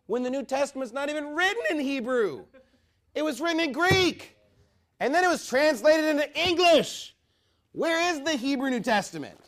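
high, strained, forceful outburst; indignant preaching. Real, not acted. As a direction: hammer the rebuttal at the top of your voice. Indignant rebuttal of Hebrew-name claim.

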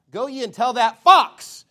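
booming scripture reading; shouted command. Acted. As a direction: fling the words at Herod. Preacher reads Christ's rebuke.

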